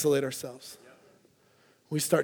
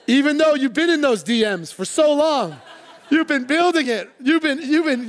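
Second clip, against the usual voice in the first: much higher and louder, laughing through it; excited preaching; 8 semitones higher, 8 dB louder than usual. Acted. Pastor teasing the congregation about a hopeful suitor, excited and laughing. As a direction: hype up the lovesick guy's hopes.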